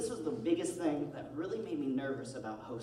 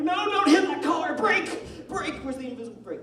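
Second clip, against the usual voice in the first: high, loud passenger panic; freaking out; 12 semitones higher, 10 dB louder than usual. Retold or imitated. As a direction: yell a panicked driving warning, hammed up. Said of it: "I freak out, like".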